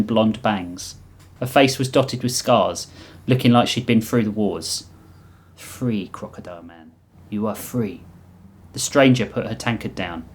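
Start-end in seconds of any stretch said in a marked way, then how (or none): 5.6-8.0 put-on soft, loosely Russian accent; vaguely Russian accent. The Reap tells Oryx he is free, soft Russian accent. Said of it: "thick veil accent that was vaguely Russian".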